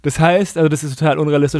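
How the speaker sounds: usual speaking voice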